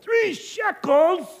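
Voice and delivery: high, slow, disbelieving "Three shekels?"; shocked traveler. Acted. Traveler balks at the innkeeper's price, stunned and drawn out. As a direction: drag it out in outrage at the price.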